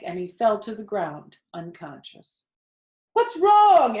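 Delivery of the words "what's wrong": loud, high alarmed cry; sudden alarm over the speaker; alarmed announcer. Acted. Loudspeaker seeing the pig faint, loud and high alarm.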